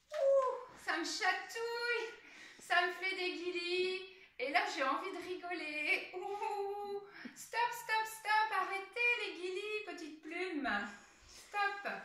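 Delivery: high, loud giggling protest; tickled, giggly. Pretend. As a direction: squeal as if being tickled. Feather tickling her.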